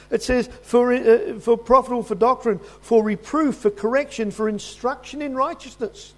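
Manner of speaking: high, ringing pulpit delivery; preaching at full force. Acted. Preacher proclaiming Second Timothy to the congregation, high and ringing.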